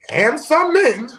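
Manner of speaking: high, loud and drawn out; loud pointed addition. Real, not acted. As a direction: stretch it out in a high, comic holler. Pointedly adding men, then laughs.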